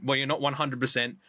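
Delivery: usual speaking voice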